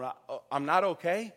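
Nicodemus, shaken and unsure, rising question. Pretend. Nicodemus asking Jesus if he is really not okay, shaken. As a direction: ask it like a man whose certainty just slipped. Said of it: "now you're asking a different tone".

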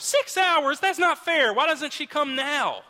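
put-on high, loud whining child; outraged kid. Kid to dad about the six-hour wait, high and loud whining. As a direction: wail it like a cheated kid.

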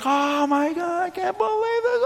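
high, awestruck gasp of a crowd; amazed crowd. Retold or imitated. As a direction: sing it out like a stunned onlooker. Crowd amazed at tightrope walker.

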